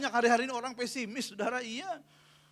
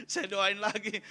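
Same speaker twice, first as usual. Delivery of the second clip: usual speaking voice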